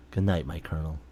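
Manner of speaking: soft low goodnight; tender goodnight. Acted. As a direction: tuck them in gently.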